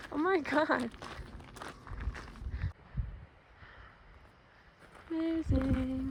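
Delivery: out of breath in thin mountain air; slow, winded words between breaths; breathless at high altitude. Real, not acted. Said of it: "The air is so thin up here".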